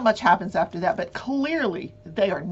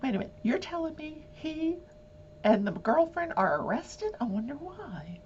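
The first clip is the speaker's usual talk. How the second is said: higher, hushed suspicious murmur; suspicious juror; 7 semitones higher, 7 dB quieter than usual. Pretend. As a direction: mutter it like a juror who's caught on. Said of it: "I'm sitting there going".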